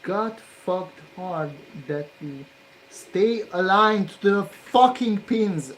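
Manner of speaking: slow, loud, exasperated rant that rises at the end; angry rant. Real, not acted. Solderer cursing the pins that won't align, furious and slow. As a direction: drag each word out in real fury.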